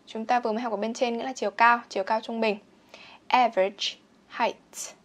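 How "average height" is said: "Average height" slowly, each final consonant popped; slow model "Average height", endings clearly sounded; consonants released. Shown as a demonstration. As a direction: let the T of "height" burst out. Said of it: "bật các phụ âm ra".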